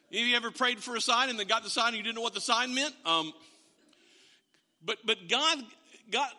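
usual speaking voice

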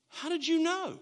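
high-pitched astonished question; acted-out wide-eyed disbelief; retold amazement. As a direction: imitate someone amazed, voice way up. Family leaders amazed by the devotion.

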